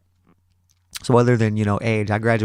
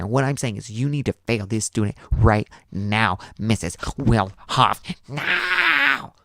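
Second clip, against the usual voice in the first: mock spoiled teen demanding; hammed-up entitled student; spoiled girl's inner voice. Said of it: "in her mind, she's thinking".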